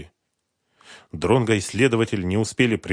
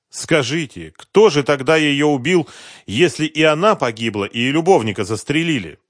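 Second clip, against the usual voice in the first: bitter betrayed husband; 6 semitones higher, 8 dB louder than usual. A narrator's reading. Husband lamenting his wife's affair, bitter. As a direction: dwell on the insult, voice raised.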